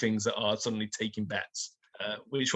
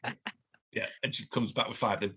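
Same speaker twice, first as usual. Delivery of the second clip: ordinary talk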